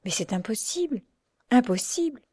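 hushed whisper of disbelief. A narrator's reading. Doctor whispering to Marianne in disbelief. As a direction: whisper it so she can't hear. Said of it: "dit tout bas le docteur".

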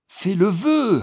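high, loud insisting repeat; insistent loud command. A narrator's reading. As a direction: demand it again, unyielding. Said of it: "répéta sa femme". (ordre répété, aigu et fort)

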